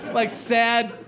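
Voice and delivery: ordinary delivery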